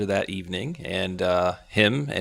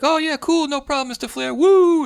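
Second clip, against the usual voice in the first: much higher, louder starstruck fan shout; excited fan; 5 dB louder than usual. Retold or imitated. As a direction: gush like a fan spotting his hero. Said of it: "The guy's like".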